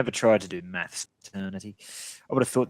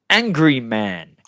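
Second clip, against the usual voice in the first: dramatic announcement; 9 dB louder, much slower than usual. Pretend. Dramatic beer reveal.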